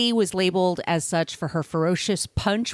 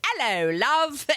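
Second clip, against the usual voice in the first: slow, higher mock English accent; imitating English accent; 6 semitones higher, slower than usual. Host imitates the barmaid girl practicing her English accent. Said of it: "practicing her English accent".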